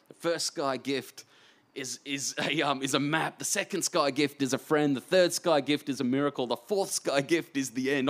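mildly hammed-up eager storytelling; eager phone pitch. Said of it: "I'm talking like this on the phone".

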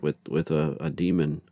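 usual speaking voice

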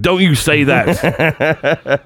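hammed-up, dragging mock warning; drawn-out mock protest. Retelling the friend's text reply, slow, high mock protest. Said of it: "He's like".

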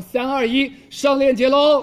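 loud, high hype call; excited countdown. Acted. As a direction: shout the countdown like a host. Countdown to product link.

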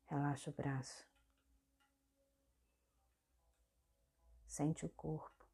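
soft meditation guide with long pauses; guided meditation. Real, not acted. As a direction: guide the body gently through each movement, leaving space between phrases. (meditação guiada, voz suave e pausada)

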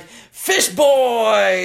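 mock grand reveal; announcing reveal. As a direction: unveil it like a showman. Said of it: "it's like".

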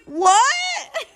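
incredulous laughing shout at her date; shocked what. Retold or imitated. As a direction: blurt it out in total disbelief. Disbelief at his nervous excuse.

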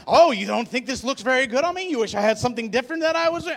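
loud, high mock-wife tirade; accusing wife. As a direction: pile on hurt accusations, speeding up. Wife accusing husband, acted quarrel.

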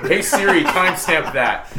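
laughing mock command to Siri. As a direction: bark it at Siri like a gadget. Jokingly commanding a phone assistant.

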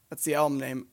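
usual speaking voice

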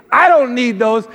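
loud retold kid's protest; mock childish indignation; indignant objection. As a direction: push back loudly at the gift.

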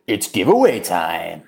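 low, slow mock announcer. Host announces the giveaway in a low mock announcer voice. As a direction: drop the voice like a game show reveal.

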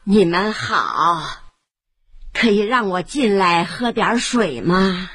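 put-on old woman asks the family for water; old granny. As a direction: speak like a gentle grandmother. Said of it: "是老婆婆".

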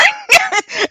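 high shouted burst of laughter. Real, not acted. Friend laughing at the Beverly Hills protest, high laugh.

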